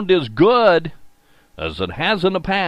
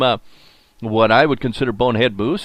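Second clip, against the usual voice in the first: ordinary delivery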